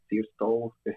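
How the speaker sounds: ordinary delivery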